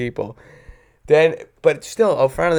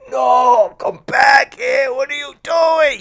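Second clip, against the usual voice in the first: high, loud, drawn-out wail across a block; hammed-up desperate shout; tearful yelling; 13 semitones higher, 5 dB louder, slower than usual. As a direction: imitate a lovesick man yelling, near tears. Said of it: "he starts yelling".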